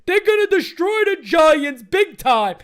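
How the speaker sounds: slow, loud taunting imitation of Aaron Boone; mocking Boone. Mocking Boone's trash talk at the Giants in a slow loud taunt. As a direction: sneer out the boast like a cocky rival fan. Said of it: "come out and be like".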